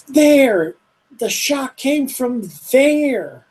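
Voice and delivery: high, slow alarmed shout; shouting townsman. Acted. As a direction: cry out and point.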